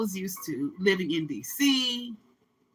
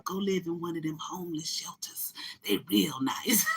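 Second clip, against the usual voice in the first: hushed, conspiratorial tip; whispered advice; 2 semitones lower, 7 dB quieter than usual. Retold or imitated. Locals tipping her off about shelters, whispering. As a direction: whisper it like a secret. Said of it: "they were trying to tell me".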